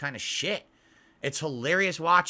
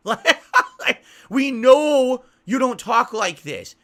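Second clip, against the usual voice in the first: laughing through the words, higher and louder; 8 semitones higher, 11 dB louder, slower than usual. Real, not acted. Mocking a poser streamer, laughing higher and louder. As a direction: laugh it out in disbelief.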